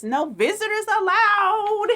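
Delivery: stern sign reading. Acted. Reader voicing the bear's stern sign to kids. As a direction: read it like you mean it. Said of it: "He is serious".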